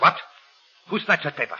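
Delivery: loud startled cry; stunned demand; shocked. Acted. Kalanza shocked.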